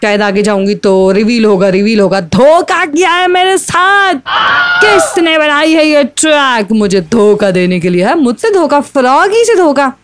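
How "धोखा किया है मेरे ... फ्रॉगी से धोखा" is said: outraged shout. Real, not acted. Gamer cheated by a fake finish flag, indignant shout.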